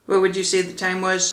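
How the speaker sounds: ordinary delivery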